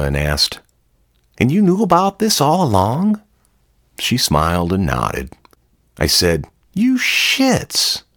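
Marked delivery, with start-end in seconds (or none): 1.4-3.2 much higher, incredulous question; can't believe what he is hearing; high, disbelieving voice asking Jana; stunned disbelief. A narrator's reading. 6.7-8.0 very high, squeaky mock curse; half laughing at being fooled; a high squeak of affectionate disbelief; astonished outburst. A narrator's reading.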